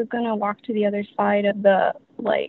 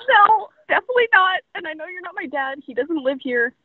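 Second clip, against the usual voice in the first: mock retort; 8 semitones higher than usual. Retorting to ghost voice.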